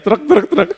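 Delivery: high, laughing trek trek trek; scissor snips. Retold or imitated. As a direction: snip it out like scissors. Imitating scissors sound. (bunyi gunting trek trek trek sambil tertawa)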